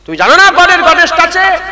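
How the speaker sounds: high, loud sneering mimicry of a modern cleric; hammed-up mocking high voice; mocking imitation. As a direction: ridicule him in a high, loud voice.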